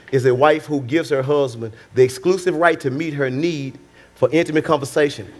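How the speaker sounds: ordinary talk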